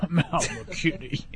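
slow, soft laughing repeat. Real, not acted. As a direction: laugh the name out quietly.

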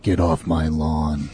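low, slow tough-guy growl; Dirty Harry. Acted. Co-host plays on the new deep voice, tough-guy impression. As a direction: drop it deep and draw out the threat. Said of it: "kinda like a Dirty Harry thing".

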